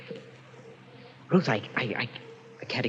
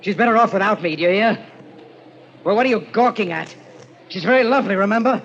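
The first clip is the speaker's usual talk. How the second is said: bitter shouting; 6 semitones higher, 5 dB louder than usual. Acted. Frank raging at the clerk.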